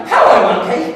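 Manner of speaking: high, loud greeting with a laugh; loud demonstrated greeting. Shown as a demonstration. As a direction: call it out playfully to the crowd. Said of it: "I want you to say".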